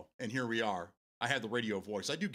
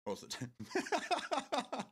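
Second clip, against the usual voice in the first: words give way to real giggles; breaking into laughter; 11 semitones higher, much slower than usual. Real, not acted. Streamer joking about living with his wife, cracking up. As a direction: repeat it, dissolving into high laughter.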